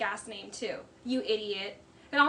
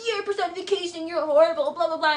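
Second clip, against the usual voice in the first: raised, higher mocking tirade; put-on outburst, louder as it goes; mock yelling rant; 4 semitones higher, 6 dB louder than usual. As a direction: imitate him ranting at her. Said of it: "till the person yells".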